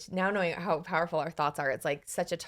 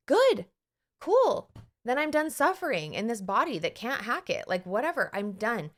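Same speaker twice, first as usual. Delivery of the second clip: breezy acceptance; 4 semitones higher, 4 dB louder than usual. Retold or imitated. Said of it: "I was like".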